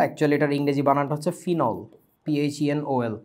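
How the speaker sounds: normal talking voice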